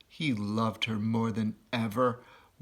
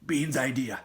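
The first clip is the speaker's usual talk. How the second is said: farmer Bunce. Acted. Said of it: "said Bunce".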